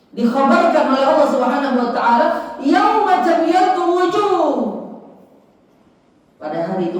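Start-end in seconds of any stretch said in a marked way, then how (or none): 0.1-4.4 ringing sermon proclamation; impassioned preaching. Acted.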